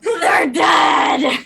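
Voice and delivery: crying out loud in grief; anguished shout. Acted. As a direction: wail it at full volume. Cries out about the dead.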